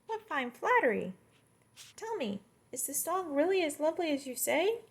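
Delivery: high, thin insect voice; sing-song little locust; high bug voice, pleased by praise. Acted. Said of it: "this is the locust talking".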